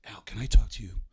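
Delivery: loud urgent stage whisper; hushed plea, whispered hard; Baldwin whisper. Retold or imitated. Baldwin pulling Pacino aside, loud whisper. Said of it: "and Baldwin says".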